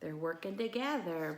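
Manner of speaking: ordinary delivery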